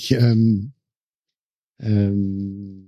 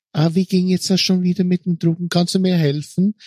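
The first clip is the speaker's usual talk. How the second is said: higher, quick put-on voice of a clueless customer; disguised customer voice; 8 semitones higher, faster than usual. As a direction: play a helpless customer asking for printer help, pitched up and hurried. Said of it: "hast du deine Stimme verstellt, um einen Kunden zu".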